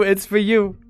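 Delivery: ordinary talk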